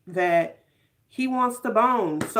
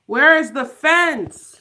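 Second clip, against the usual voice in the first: outraged demand; 3 semitones higher, 7 dB louder, slower than usual. Real, not acted. Outraged at missing fence scene.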